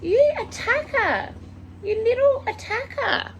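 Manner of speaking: loud, very high pet voice; playful accusation. Real, not acted. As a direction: squeal mock blame at your little attacker.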